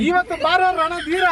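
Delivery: slow, high declaimed challenge; film dialogue. Shown as a demonstration. Kidnapper feeding her the next line, drawn-out film dialogue. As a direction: stretch the line out in heroic style. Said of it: "ಒಂದ್ dialogue ಹೊಡೀರಿ".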